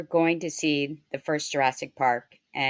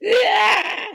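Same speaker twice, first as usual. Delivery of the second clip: sudden high, loud creature shriek; imitated dinosaur jump-scare scream; baby dinosaur screech; 6 dB louder than usual. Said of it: "one of the baby dinosaurs goes".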